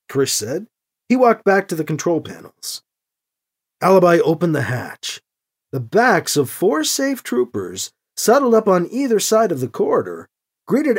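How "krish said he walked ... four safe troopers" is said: usual speaking voice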